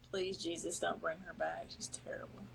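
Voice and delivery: soft, hushed mock plea; whispered audience thoughts. Host voicing the audience's private dread, hushed whisper. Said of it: "That's what they're thinking".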